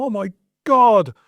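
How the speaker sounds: overwhelmed groan. Retold or imitated. Husband overwhelmed by cupboard mess.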